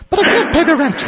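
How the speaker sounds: high, wailing damsel voice; damsel in distress. Retold or imitated. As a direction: squeal it like a helpless heroine. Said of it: "it's an old melodrama".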